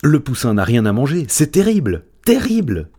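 insistent alarm. Acted. Je sais tout insisting to the cats, climbing alarmed cry. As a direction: build the panic, last terrible highest. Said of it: "insista Je sais tout".

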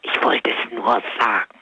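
whispering with a lost voice; strained whisper, no voice left; voiceless whisper. Real, not acted. Caller whispering to the radio host because her voice is gone.

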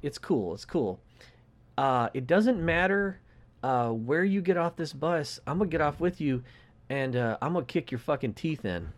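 soft, even, friendly-sounding threat; calm, polite menace. Retold or imitated. Young guy quietly promising the old man a beating, calm. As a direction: threaten him gently, nice as pie. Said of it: "Calm, poli-, calm, nice as pie".

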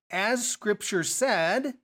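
slow "As Scripture said", rising on "said"; new sentence opening. Shown as a demonstration. Teacher starting another sentence to lead into the next quote, slow suspended phrasing. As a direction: stretch "As Scripture said" and leave "said" hanging upward. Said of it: "gonna start another sentence".

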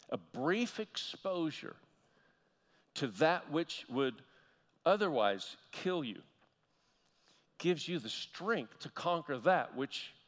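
slow, word by word, letting each phrase land; slow, deliberate repeat. Shown as a demonstration. Pastor repeats the key line slowly for the congregation. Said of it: "let that resonate just for a moment".

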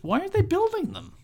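a high, incredulous question; baffled rhetorical outburst; exasperated disbelief. Retold or imitated. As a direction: throw up your hands in disbelief.

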